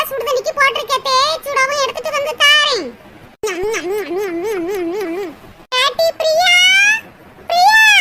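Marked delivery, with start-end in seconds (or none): 3.5-5.3 chewing noises. Acted. Eating noisily, chewing sounds. 5.8-6.5 raised voice calling her name. Acted. Calling Priya from afar. 7.5-7.9 loud, high shout of the name; calling out loudly. Acted. Calling Priya again, no answer.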